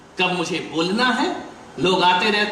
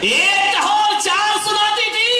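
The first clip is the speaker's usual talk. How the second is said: mocking exclamation; 12 semitones higher, slower than usual. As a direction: hoot it out in disbelief. Mother-in-law recalling the old insolence.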